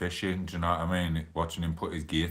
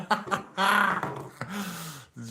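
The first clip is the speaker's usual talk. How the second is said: high laugh; 13 semitones higher than usual. Real, not acted. Big laugh at own joke.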